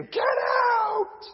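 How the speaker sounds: high, loud mock scream of a woman in labor. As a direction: yell her out of the room. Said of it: "is gonna scream at you".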